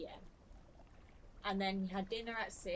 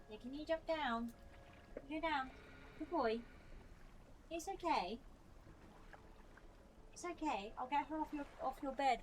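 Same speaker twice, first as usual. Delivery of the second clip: pet talk; 6 semitones higher than usual. Real, not acted. Urging the dog to jump down.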